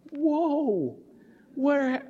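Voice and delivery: high, drawn-out whoa; stunned amazement. Retold or imitated. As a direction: re-enact being floored by how she's grown. Acts out stunned reaction to bride.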